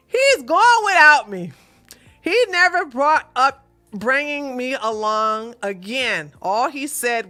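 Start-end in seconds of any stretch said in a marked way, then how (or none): 0.1-1.5 higher, louder mock indignation; mock outrage. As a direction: read it like a scandalised friend. Mock outraged reading of letter.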